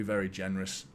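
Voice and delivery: normal talking voice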